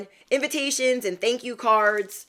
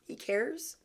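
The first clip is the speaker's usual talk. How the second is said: stunned disbelief; 4 semitones higher, 9 dB quieter than usual. Retold or imitated. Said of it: "And I was like".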